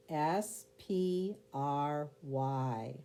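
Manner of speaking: slow letter-by-letter spelling. Shown as a demonstration. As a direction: spell out each letter slowly and clearly. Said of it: "Go ahead and spell it".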